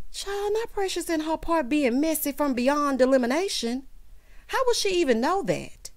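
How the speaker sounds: high-pitched, scandalized disbelief; incredulous. Real, not acted. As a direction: question it in high, indignant amazement. Incredulous reaction to gossip about Precious.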